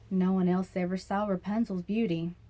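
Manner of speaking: usual speaking voice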